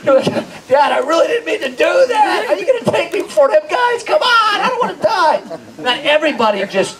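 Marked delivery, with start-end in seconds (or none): 0.0-5.4 high, hammed-up begging child; panicked pleading. Kid begging dad not to hand him over, mock panicked pleading.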